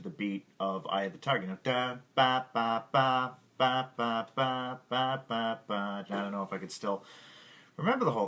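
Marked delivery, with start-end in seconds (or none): none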